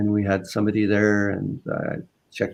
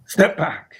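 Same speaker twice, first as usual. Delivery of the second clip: higher, sharp police command; retold officer's abrupt command; imitated cop order; 7 semitones higher than usual. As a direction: order someone away like a cop.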